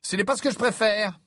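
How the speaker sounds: high, awkward polite refusal; hasty excuse. A narrator's reading. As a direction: squirm out of the offer. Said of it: "s'empressa de répondre".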